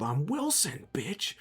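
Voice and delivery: high, lightly yelled creature taunt; Wilson. Retold or imitated. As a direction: imitate a little monster's yell without full volume. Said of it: "and it just yelled".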